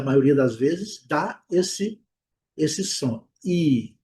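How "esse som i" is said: ordinary talk, nothing marked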